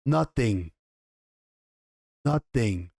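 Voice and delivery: low, cold dismissal. Retold or imitated. Mother dismisses her pained son, low and cold. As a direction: drop it low and let it wound.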